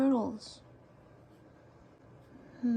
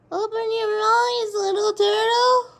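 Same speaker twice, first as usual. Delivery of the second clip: higher, louder and slower call to the turtle; louder coaxing; 7 semitones higher, 6 dB louder than usual. Real, not acted. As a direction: coax it out, calling up high and slow.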